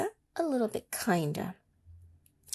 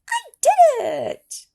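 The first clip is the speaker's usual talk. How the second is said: triumphant shout; 12 dB louder, slower than usual. Acted. Fly reaches top of mountain.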